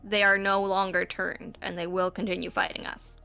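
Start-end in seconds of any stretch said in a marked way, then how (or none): none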